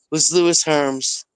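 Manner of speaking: ordinary talk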